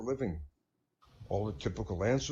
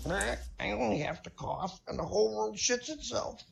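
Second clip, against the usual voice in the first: chicken voice; 8 semitones higher than usual. Acted. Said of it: "The chicken says".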